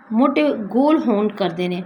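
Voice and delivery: ordinary talk, nothing marked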